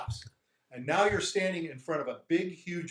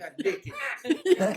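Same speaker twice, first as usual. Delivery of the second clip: high, slow, laughing gnome; chuckling gnome complaint; 11 semitones higher, slower than usual. Acted.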